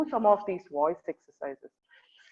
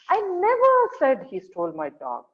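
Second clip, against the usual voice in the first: "never" high, loud and stretched; stress on NEVER; 8 semitones higher, 5 dB louder than usual. Shown as a demonstration. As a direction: lean hard on "never", then let the rest fall. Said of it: "emphasizing different words".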